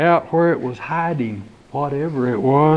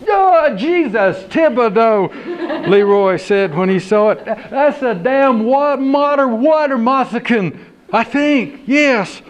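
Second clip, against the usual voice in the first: startled exclamation; 7 semitones higher, 3 dB louder than usual. Acted. Leroy seeing the snake, startled and high. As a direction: exclaim in alarm.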